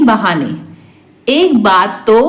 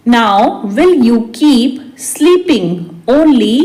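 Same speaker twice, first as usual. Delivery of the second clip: measured, word by word; slow repeat. Shown as a demonstration. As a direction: hold back the last word. Teacher repeats translation word by word. (धीरे-धीरे, शब्द-दर-शब्द)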